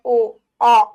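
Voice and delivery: rounded ओ, then wide आ, loud; ओ and आ sounded separately, loudly; vowel contrast. Shown as a demonstration. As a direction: contrast small ओ with big open आ. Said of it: "बड़ा मुंह खुल रहा है".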